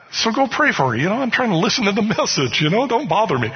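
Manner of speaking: exasperated brush-off of a friend during a sermon. Retold or imitated. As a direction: wave him off, fed up.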